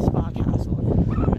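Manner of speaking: slow, breathy spooky whisper; hushed and drawn out for effect; dramatic whisper. Pretend. Repeats the name dramatically.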